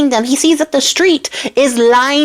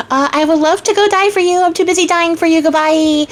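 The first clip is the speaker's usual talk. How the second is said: high, sustained singsong call; mock-cheery farewell; 7 semitones higher than usual. Charming leaving to die for the princess, mock-cheery farewell. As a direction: sing it out while leaving, playing the martyr.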